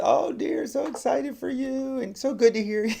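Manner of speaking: put-on very high, delighted old-lady voice; excited great grandmother. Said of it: "great grandmother's so exci".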